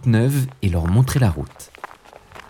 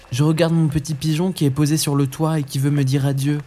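put-on a touch higher boyish voice; lightly voiced Hansel; 7 semitones higher than usual. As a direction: answer father innocently as a young boy. Hansel answers.

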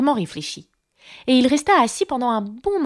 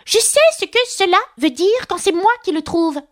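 high, indignant duck voice; pitched-up, argumentative retort; 11 semitones higher than usual. A narrator's reading. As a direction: snap back as an affronted duck. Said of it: "rétorqua le canard".

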